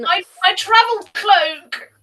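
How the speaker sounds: high, loud cry of dismay; mock dismay. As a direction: lament a damaged treasure, hammed-up. Player voices character hit by arrow.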